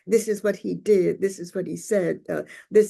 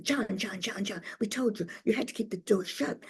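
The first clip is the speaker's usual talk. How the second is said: hushed urgent scolding; 2 semitones higher, 8 dB quieter than usual. Retold or imitated. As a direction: hush it, repeating the name urgently. Attendant urgently cautions pilot, retold.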